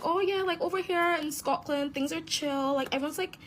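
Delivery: high sing-song sarcastic gush; mock naive gushing. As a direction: parody someone claiming all is rosy. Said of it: "I'm not gonna act like".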